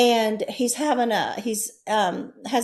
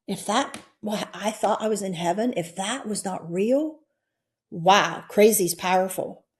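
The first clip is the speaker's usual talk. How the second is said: usual speaking voice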